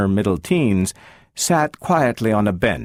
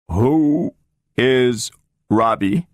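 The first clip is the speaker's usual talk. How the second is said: mechanical booming robot; 4 semitones higher, much slower than usual. A narrator's reading. The talking robot answers Gloria in a slow mechanical boom. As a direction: boom it out like a machine, no intonation.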